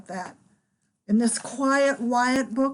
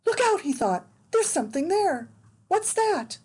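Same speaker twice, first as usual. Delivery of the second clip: alarmed; 7 semitones higher than usual. Acted. Eddie alarmed in dark woods.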